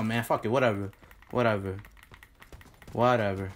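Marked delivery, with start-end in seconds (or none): none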